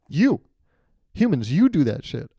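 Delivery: mock accusing. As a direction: playfully point the blame with a high, punched YOU. Acted sea lion accusing humans.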